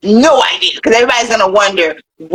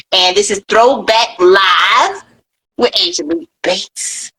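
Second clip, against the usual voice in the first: announcer voice; slower than usual. Acted. Show sign-off announcement.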